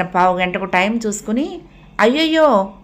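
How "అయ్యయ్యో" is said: high, louder alarmed అయ్యయ్యో; a sudden oh-no of a girl who overslept; dismayed cry. A narrator's reading. Girl notices the late time. (ఆందోళనగా అయ్యయ్యో)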